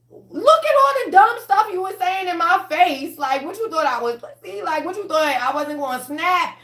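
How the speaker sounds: high, loud, heated clapback; angry confrontation. Retold or imitated. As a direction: tell them off, fired up. Retelling confronting a provoker.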